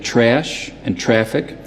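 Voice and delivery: slow New York "trash" and "traffic", vowel gliding like "air"; tense New York "a", toward "eh-uh"; New York accent. Shown as a demonstration. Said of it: "In a New York accent".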